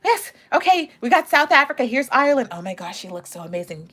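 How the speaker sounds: bubbly backstage host. Pretend. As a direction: play the giddy backstage host. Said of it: "I'd be like".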